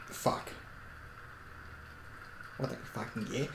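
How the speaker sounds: usual speaking voice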